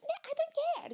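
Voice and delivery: high-pitched refusal; defiant high self. Acted. Reluctant self refusing the countdown, high defiance.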